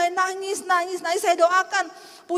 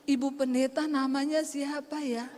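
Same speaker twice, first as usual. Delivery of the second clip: softened, polite voice of a changed woman; smiling sweet question; 6 dB quieter than usual. Retold or imitated. Woman relieved after crying asks the pastor's name, smiling. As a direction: ask gently through a smile. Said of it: "dia senyum, dia tanya".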